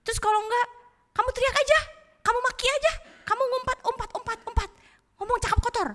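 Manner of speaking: high-pitched tempter, urging; devil egging on. Acted. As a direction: pile up wicked suggestions in a high voice. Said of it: "dia bilang gini".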